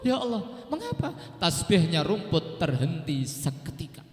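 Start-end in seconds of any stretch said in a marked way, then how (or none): 0.0-1.1 very high, pleading wail; imitating an anguished, tearful plea; acted weeping. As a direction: cry out to God as if in tears. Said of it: "berhenti nangis".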